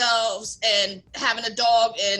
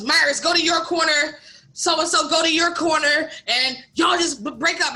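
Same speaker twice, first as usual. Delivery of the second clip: manager breaking it up; 5 semitones higher, 5 dB louder than usual. Retold or imitated. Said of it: "He comes over and goes".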